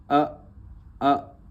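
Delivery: अ said short and clear as a bare sound; phonic sound. Shown as a demonstration. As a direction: sound out अ on its own for the class. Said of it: "ए की sound होती है जैसे". (अक्षर की ध्वनि अ अलग से बोलना)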